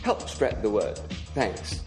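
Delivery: normal talking voice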